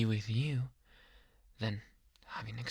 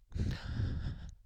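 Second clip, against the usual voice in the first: breathy obsessive giggle. Acted. Laughs while adoring patient.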